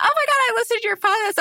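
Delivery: high, fast gushing listener impression; excited fan. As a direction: imitate a thrilled fan in a rush.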